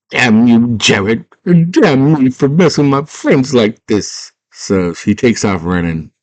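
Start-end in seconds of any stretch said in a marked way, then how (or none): 0.1-4.3 loud, high mock outburst; hammed-up indignant character voice; acted angry curse. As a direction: curse the villain, getting higher as you go. Character curses villain in retelling.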